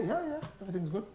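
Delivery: put-on teen fobbing off a worried parent; mumbling teen. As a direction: rush a dismissive teen answer.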